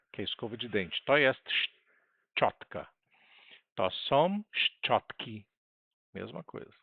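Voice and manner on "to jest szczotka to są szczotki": "To jest szczotka" drawn out word by word, every sound clear; very slow model reading. Shown as a demonstration. Teacher slowly models "szczotka" for the class. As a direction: spell it out slowly so the class can copy the Polish. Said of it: "teacher models singular and plural".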